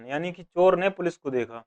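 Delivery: ordinary delivery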